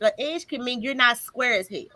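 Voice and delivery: normal talking voice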